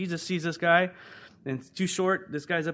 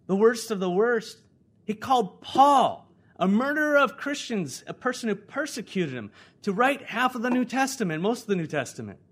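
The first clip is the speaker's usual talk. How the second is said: raised, high, amazed preacher's voice; impassioned preaching; 7 semitones higher, 6 dB louder than usual. Real, not acted. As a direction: proclaim it loud with astonishment. Preaches on Paul's calling.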